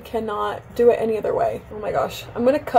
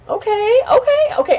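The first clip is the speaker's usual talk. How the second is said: high, louder flustered repeats; flustered squeak; 12 semitones higher, 5 dB louder than usual. Real, not acted. Vlogger collecting herself mid-haircut, high flustered squeak. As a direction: squeak it out to steady yourself. Flustered after a big cut.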